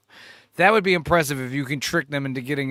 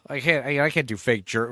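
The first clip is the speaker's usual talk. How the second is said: usual speaking voice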